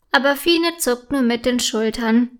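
ordinary delivery, nothing marked

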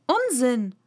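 firm, decisive retort; loud and firm. A narrator's reading. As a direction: say it firmly, cutting the talk off. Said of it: "sagte Alice sehr laut und bestimmt".

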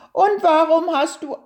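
high, quick questioning turtle voice; curious little turtle. A narrator's reading. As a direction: ask eagerly as a puzzled turtle.

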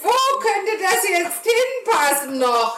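put-on puzzled kid voice. Brother puzzling over spare parts.

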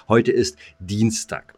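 normal talking voice

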